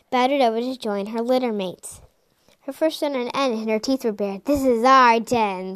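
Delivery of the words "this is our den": slow, loud hammed-up growl of a fox; old elder playing a fierce fox mother; mock hiss. A narrator's reading. Elder pretending to be a fox guarding her den, slow and loud. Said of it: "Silver Flame hissed".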